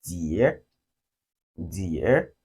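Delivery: DEAR starting low, then rising; low dip and upswing on DEAR; falling-rising tone. Shown as a demonstration. English word to recall the sound, dipping DEAR. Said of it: "You can use the English word".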